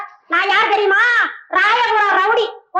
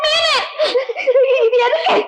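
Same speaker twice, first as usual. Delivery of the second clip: high shout; 5 semitones higher than usual. Acted. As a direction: shriek it high in the quarrel. Quarrel, shouting. (உயர்ந்த குரலில் கத்தல்)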